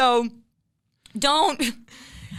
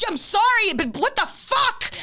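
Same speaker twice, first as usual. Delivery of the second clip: exasperated rant; 10 semitones higher than usual. Acted. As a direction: vent at a brake-happy driver, pitched high. Continues rant at brake-happy drivers.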